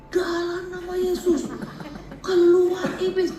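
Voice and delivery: high, slow hammed-up casting out; mock exorcism. Visiting church lady casts out a demon, hammed-up and slow. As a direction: command the devil out, slow and high. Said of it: "Dia datang terus dia gini".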